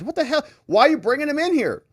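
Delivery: high mock exasperation; frustrated judge. Judge complains about new text messages, high and fed up. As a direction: throw up your hands at the lawyer. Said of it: "appears frustrated with their exhibit management".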